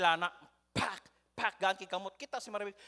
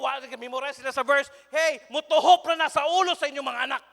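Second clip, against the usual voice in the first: loud, emphatic sermon delivery; forceful pulpit voice, building louder; full-force preaching; 4 semitones higher, 10 dB louder than usual. Real, not acted. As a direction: preach it out to the congregation, driving each point.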